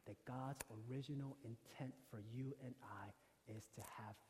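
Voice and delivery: very soft, low and measured; hushed solemnity. Real, not acted. Preacher rebuts the movie's line on death, hushed. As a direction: drop right down, grave and deliberate.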